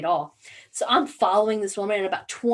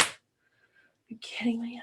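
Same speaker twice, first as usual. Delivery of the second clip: hushed, slow, disbelieving whisper; whispered disbelief; 3 semitones higher, 9 dB quieter, much slower than usual. Retold or imitated. Stuck behind a texting driver, whispered disbelief. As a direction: breathe it out under your breath in disbelief. Annoyed driver muttering at texter.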